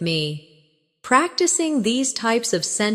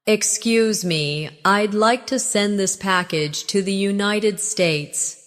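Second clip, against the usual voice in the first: slower, evenly paced model sentence; slow practice take. Shown as a demonstration. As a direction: say it slowly for learners to repeat. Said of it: "Say it again slowly".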